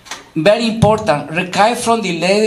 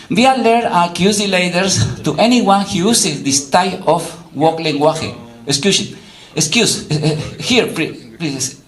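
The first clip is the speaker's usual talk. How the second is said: normal talking voice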